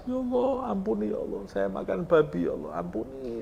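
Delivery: high, wailing plea that fades away; crying prayer. Acted. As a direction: wail to God for forgiveness, half sobbing. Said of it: "makan sama nangis". (meratap memohon ampun, suara tinggi)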